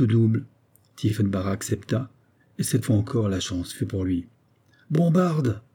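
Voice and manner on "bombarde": high, drawn-out cry; pitched-up outburst; exclaiming colossus. A narrator's reading. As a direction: exclaim it high with mild force. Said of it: "s'écria le colosse en frappant violemment".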